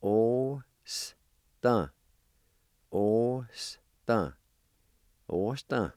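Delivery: Osta said O-sta, drawn out and falling; careful model word. Shown as a demonstration. Teacher modelling the Cherokee word for good. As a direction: model Osta slowly for learners to copy. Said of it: "And it's pronounced like this".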